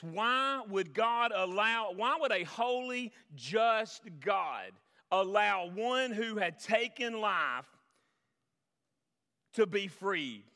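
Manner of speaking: a preacher's drawn-out climax; preaching at full force. Acted. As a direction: stretch every word of the question.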